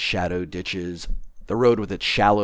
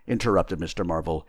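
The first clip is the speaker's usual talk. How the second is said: ordinary delivery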